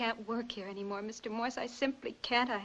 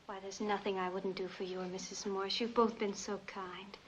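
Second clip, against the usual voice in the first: normal talking voice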